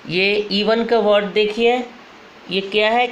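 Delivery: ordinary talk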